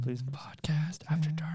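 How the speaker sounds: eerie spooky whisper; breathy late-night whisper. Pretend. Spooky podcast intro whisper.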